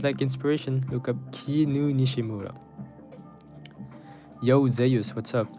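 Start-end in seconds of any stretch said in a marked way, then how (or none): none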